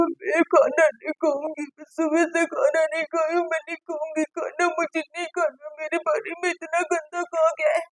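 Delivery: a soft mock sob mocking her sulk; mock crying. Mocking Tania refusing food after the fall, in a soft, whiny mock sob. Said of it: "तो उसका रोना चालू".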